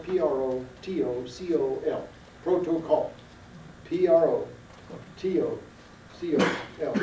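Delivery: P R O T O C O L, each letter said on its own; spelled out. Shown as a demonstration. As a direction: dictate the spelling to the class. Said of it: "Do you know the word protocol?".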